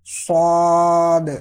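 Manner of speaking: صاد long and full-mouthed; full heavy saad. Shown as a demonstration. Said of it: "پر پڑھنا ہے".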